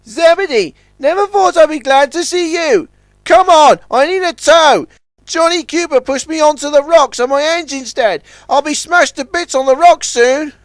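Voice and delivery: high urgent voice; customs launch. A narrator's reading. Stranded launch begging Zebedee for a tow, high. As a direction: plead for a tow.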